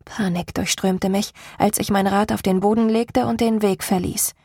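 ordinary delivery, nothing marked

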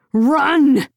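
desperate shouted command; urgent shout. A narrator's reading. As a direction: call out urgently, pitch jumping up. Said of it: "the man shouted".

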